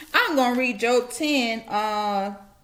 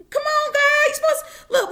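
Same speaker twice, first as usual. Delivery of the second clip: high, loud, pleading protest; a preacher half-laughing in disbelief at God; exasperated plea; 4 dB louder than usual. Real, not acted. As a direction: throw your hands up at heaven.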